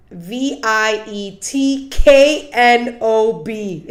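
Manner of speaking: slow letter by letter spelling; spelled out slowly. Shown as a demonstration. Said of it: "spelled like this".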